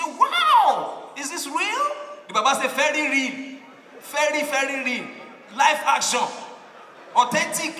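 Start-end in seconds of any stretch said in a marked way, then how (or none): 0.0-1.9 much higher and louder, amazed foreigner's shout; shocked gasp. Retold or imitated. Foreign visitor seeing naked men fight, high shocked shout. 2.7-7.7 loud, higher, emphatic "very, very real"; proud confirmation. Retold or imitated. Old driver assures the visitor it is real, loud and proud.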